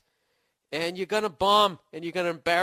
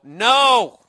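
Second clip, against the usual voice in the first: loud, higher-pitched refusal; mock rejection; 6 semitones higher, 12 dB louder than usual. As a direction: blast out a blunt rejection. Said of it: "then they would go".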